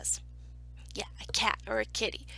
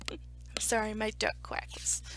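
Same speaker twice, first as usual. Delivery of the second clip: ordinary delivery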